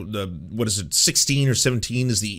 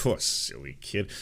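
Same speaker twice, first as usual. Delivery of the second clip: slow, low and softer, patronizing; mock indulgent dismissal; 5 semitones lower, 8 dB quieter than usual. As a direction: wave the child off with lazy condescension. Same dismissive adult, repeated.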